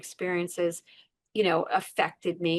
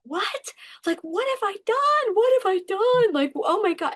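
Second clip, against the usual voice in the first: much higher, rising into a frantic cry; panicked disbelief; 11 semitones higher, 5 dB louder than usual. Retold or imitated. Woman woken by police, asking what she has done. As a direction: gasp it out in shock, voice climbing. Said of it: "And I'm like".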